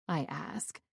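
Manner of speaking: ordinary delivery, nothing marked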